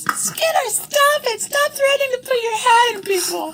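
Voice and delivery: very high, loud squeaky scold; tiny fairy scolding. Acted. Honeysuckle the fairy shouting at Skinner, very high squeaky scold. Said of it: "Honeysuckle flies over and goes".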